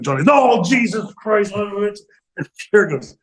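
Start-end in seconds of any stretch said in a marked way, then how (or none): 0.2-2.0 mock anguish. Johnny panics over broken nose.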